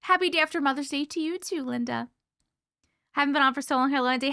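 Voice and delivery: ordinary talk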